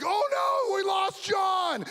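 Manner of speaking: high, drawn-out cry of alarm; hammed-up wail after a lost friend; mock panicked shout. Kids see friend fall off.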